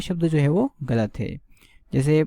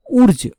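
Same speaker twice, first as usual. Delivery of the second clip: Wurtz said much higher and louder; emphasized syllable; 9 semitones higher, 8 dB louder than usual. Shown as a demonstration. Teacher stressing Wurtz for students. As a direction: punch Wurtz sharply. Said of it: "यहाँ पे ध्यान रखिएगा".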